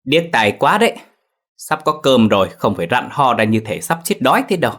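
high, loud, sharp-tongued scolding; shrill scolding. A narrator's reading. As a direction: snap at the old mother-in-law, high and loud. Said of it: "giọng chua ngoa đanh đá". (quát chua ngoa, giọng cao và to)

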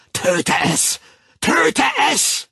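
loud, high frenzied roar; bellowing. A narrator's reading. The Savage whipping himself, bellowing. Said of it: "brüllte der Wilde unentwegt".

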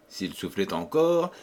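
plain reading voice

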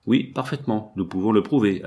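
usual speaking voice

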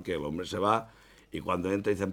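ordinary talk

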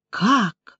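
usual speaking voice

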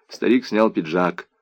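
plain reading voice

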